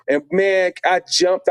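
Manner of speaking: usual speaking voice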